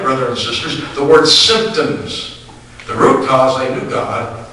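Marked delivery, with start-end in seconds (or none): 1.3-2.4 symptoms said slowly and with weight; stress on symptoms. Shown as a demonstration. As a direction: lean hard on symptoms. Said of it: "And I emphasize tonight".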